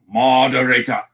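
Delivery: "Moderator" in a mock-grand accent, ending on "-ah"; put-on accent with an open final vowel. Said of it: "You don't like that accent?".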